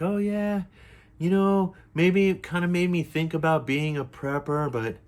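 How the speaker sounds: high, giggly influencer voice; lightly mock the bubbly TikToker; mimicking giggly influencer. Mocking the giggling influencer, high and bubbly. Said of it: "laughing and giggling".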